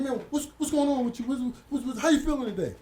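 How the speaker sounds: a cellmate fussing over you; imitating June pressing. June voiced pressing again.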